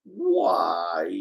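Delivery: low, drawn-out moan; hammed-up sinking lament; mock anguished why. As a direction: moan it in fake despair. Repeats the running why gag.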